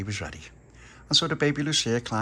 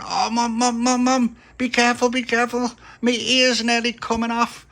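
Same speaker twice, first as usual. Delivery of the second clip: put-on whining child; 8 dB louder than usual. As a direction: whine like your ear is being pulled off. Son whines while face scrubbed.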